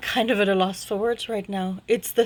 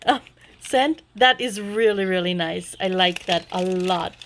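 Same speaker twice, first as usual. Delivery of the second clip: ordinary delivery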